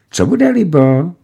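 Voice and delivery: high, sweetly fawning clerk; sugary voice. A narrator's reading. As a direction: coo the question up high. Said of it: "ptal se nasládným hlasem". (sladce podlézavým hlasem)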